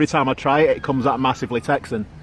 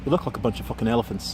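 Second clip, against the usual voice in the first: quick, low Irish accent attempt; softer Irish accent with a bit of twang; 5 semitones lower, 5 dB quieter, faster than usual. Auditioner having another go for Mark, Irish accent with twang. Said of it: "Bit of a twang".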